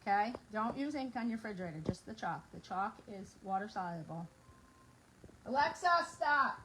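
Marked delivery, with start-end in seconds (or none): 5.5-6.6 calling out. Real, not acted. Calling to smart speaker.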